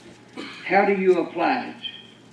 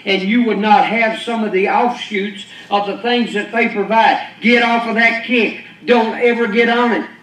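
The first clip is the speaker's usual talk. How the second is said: louder, driving sermon delivery; forceful preaching; 4 semitones higher, 5 dB louder than usual. Acted. Preacher scolds the congregation, loud and driving. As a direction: hammer the point home to the congregation.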